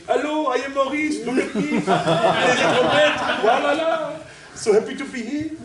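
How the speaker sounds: hammed-up French accent, high and loud. Teacher imitating the jolly French trumpeter on stage, hammed-up French accent. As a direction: play a jolly fat Frenchman greeting the crowd, in a mock French accent. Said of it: "who I saw do that was [name]".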